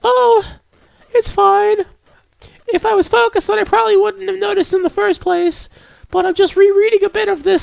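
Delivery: put-on very high falsetto girl voice; squeaky-high, reassuring character voice; shy, apologetic game girl. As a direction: play a bashful bookish girl way up in falsetto.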